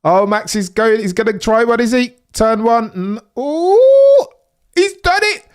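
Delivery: rising to a very high, loud squeal as the move sticks; excited. Real, not acted. Max overtakes on turn one.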